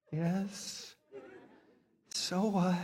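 hushed, reluctant mock surrender; meek concession. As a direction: give in grudgingly to the conscience. Meekly giving in to inner voice.

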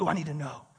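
low, soft, amused eagerness; mock intrigue. Preacher imitating someone falling for clickbait, hushed. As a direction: play the hooked reader under your breath. Acts the hooked clickbait reader.

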